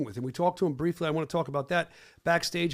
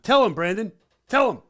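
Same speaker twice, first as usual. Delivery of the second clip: louder, higher call for backup; loud demand; 4 semitones higher, 7 dB louder than usual. Real, not acted. Demanding his friend back him up, loud. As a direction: rope him in.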